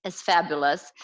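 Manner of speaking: ordinary delivery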